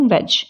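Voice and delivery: वेज ending on a simple j; वेज, no d before j; silent D. Shown as a demonstration. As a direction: say वेज as wej. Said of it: "इसको हम बोलते हैं".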